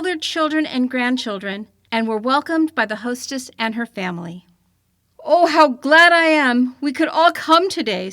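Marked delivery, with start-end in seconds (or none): 5.2-8.1 brisk bright greeting, a bit louder and higher; a cold crisp winter gentleman glad to arrive; crisp clear tones. A narrator's reading. Mister January greets the family, crisp and clear. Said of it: "said Mister January in his crisp, clear tones".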